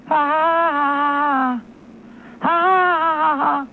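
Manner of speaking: high put-on mocking laugh. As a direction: cackle at the victim as the clerk. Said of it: "they're just gonna laughingly hit the key".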